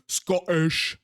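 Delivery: loud, slow attempt at a Scottish accent. Shown as a demonstration. Streamer playing with dwarves' accent, amateur Scottish accent. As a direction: have a go at a broad Scottish "Scottish". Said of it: "Not particularly good at it".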